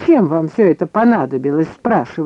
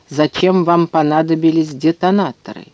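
ordinary delivery

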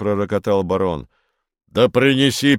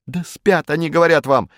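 put-on raised, protesting boy's cry; indignant cries; 6 semitones higher than usual. A narrator's reading. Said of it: "негодующие крики Уна". (негодующий выкрик мальчишки)

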